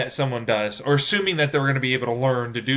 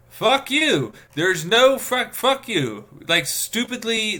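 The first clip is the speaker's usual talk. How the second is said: high, loud exasperated swearing; real annoyance boiling over at useless instructions; really annoyed; 10 semitones higher, 4 dB louder, slower than usual. Real, not acted. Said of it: "I'm actually really annoyed".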